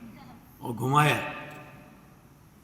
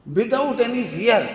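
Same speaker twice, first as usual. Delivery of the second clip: without any fear stretched out slowly and higher; emphatic and slow; 5 semitones higher, 3 dB louder, much slower than usual. Real, not acted. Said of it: "मैं example दूंगा". (धीरे और ज़ोर देकर "without any fear")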